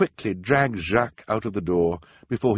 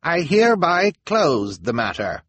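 raised, loud, formal pronouncement from an elder; elder's ruling; 9 semitones higher, 7 dB louder than usual. A narrator's reading. Elder closing the matter before the townspeople, loud and formal. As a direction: declare it like a council elder closing the case. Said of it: "an elder said".

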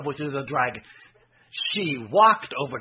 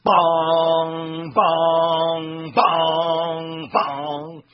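bong sounds; 3 semitones higher, 4 dB louder, much slower than usual. A narrator's reading. Knocking on the dragon's door.

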